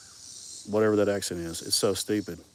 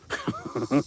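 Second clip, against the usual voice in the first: high-pitched giggle; short burst of high laughter; laughing. Real, not acted. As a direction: giggle at your own story. Laughs at own accent switching.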